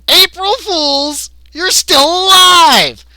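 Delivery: high, loud drawn-out announcement; gleeful alien prankster. Acted. Gray revealing the prank to captives, high loud gleeful cry. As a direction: belt out the big reveal, stretching the words.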